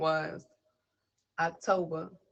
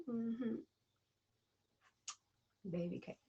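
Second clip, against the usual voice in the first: ordinary talk, nothing marked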